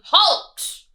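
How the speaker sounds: loud bellowing villain; bellowing rat. Acted. Highway rat bellowing at the ants, loud. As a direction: bellow the order. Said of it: "who bellowed a deafening".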